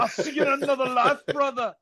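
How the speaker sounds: high, laughing put-on voice; playful high impression of a TV hero; hammed-up show character. Quoting a show line in voice.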